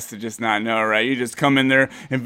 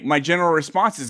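ordinary talk, nothing marked

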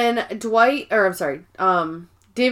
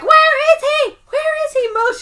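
very high, shrill demanding scream; furious shrieking; 5 dB louder than usual. Retold or imitated. Angela demanding Mose tell her where Dwight is, screeching. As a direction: shriek it while slapping him.

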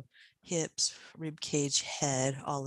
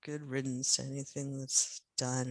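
normal talking voice

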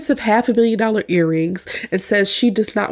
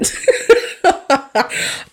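airy, higher giggling; real laughter; 6 semitones higher than usual. Real, not acted. Host amused by the see-through veil, giggling. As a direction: laugh at how silly it is.

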